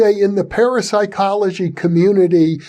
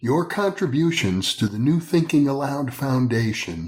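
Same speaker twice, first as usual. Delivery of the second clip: measured, lower promo read; announcer read; 4 semitones lower, 6 dB quieter than usual. Acted. Host reading the foundation appeal, steady announcer read. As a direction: read it like a sponsor message.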